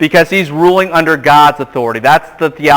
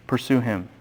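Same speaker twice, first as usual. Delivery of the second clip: emphatic softening; 4 semitones lower, 14 dB quieter than usual. Shown as a demonstration. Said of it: "repeated for emphasis".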